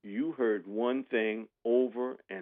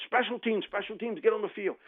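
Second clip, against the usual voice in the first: retold hollering; 11 semitones higher than usual. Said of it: "I'm hollering".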